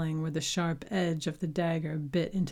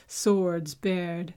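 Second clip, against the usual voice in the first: ordinary delivery, nothing marked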